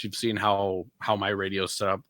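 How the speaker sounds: ordinary talk, nothing marked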